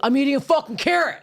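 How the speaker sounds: mock heckler, pitched well up; retold loud heckler. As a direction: imitate an obnoxious audience member butting in. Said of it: "this guy being very loud".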